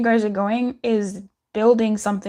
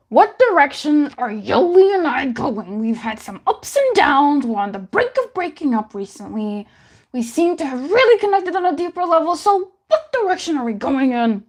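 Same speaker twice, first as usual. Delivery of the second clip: reads the question in a higher, strange Joker-like voice, racing the middle line; a playful Joker read of a viewer's question; 4 semitones higher than usual. Pretend. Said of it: "It's giving Joker, how I was reading that".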